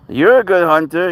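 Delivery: doting pet voice; cat praise. Real, not acted. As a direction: gush over the cat's hunting.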